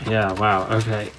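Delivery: muffled behind a respirator mask; through a respirator. Real, not acted. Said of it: "it's 'cause I have a respirator on".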